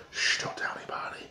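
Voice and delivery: hushed, conspiratorial whisper; a mock-secret whisper with a shush first; playful whisper. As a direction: let viewers in on a secret in a whisper. Secret favourite player, hushing viewers.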